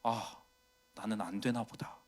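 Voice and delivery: soft, low, giving-up sigh of a line; quietly resigned self-talk; dejected. Retold or imitated. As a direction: let the voice sink as self-esteem drops. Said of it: "자존감 막 내려가고".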